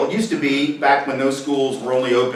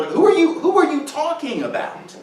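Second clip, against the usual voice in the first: mock incredulous; 13 semitones higher than usual. Pointed rhetorical question.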